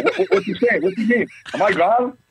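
a player thrown by the coach's joke, mock panic.

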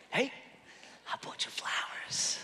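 soft whisper to someone asleep; hushed whisper. Retold or imitated. Husband whispers to his sleeping wife, hushed whisper. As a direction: whisper it very gently, barely voiced.

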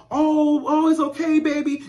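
put-on high, cooing baby comfort; soothing a crying toddler. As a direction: coo to a hurt little girl. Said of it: "The parents go".

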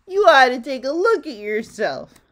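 loud, lightly voiced amused retort; laughing Mister Greedy. A narrator's reading. Mister Greedy mocks Little Miss Bossy, loud retort. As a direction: toss the remark back loudly. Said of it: "laughed Mister Greedy".

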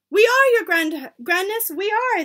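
high, loud eager chorus; cried out. A narrator's reading. Witches assuring their leader, cried out. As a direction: answer eagerly at full pitch. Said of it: "they cried".

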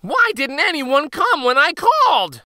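high, loud, upset complaint; crying boy. A narrator's reading. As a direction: wail it in a high boyish voice. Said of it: "The boy ran to town crying".

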